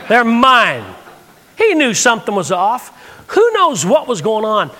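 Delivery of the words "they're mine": louder, planted insistence; firm repeat. Retold or imitated. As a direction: stand your ground with it. Repeated firmly to guard.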